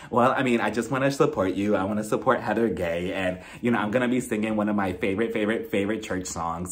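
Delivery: a hint of a low put-on voice; Lisa. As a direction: trail down into a low, soft explanation. Voicing Lisa explaining herself to Heather.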